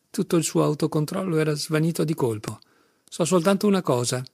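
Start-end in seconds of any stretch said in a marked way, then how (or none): none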